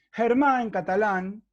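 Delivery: ordinary talk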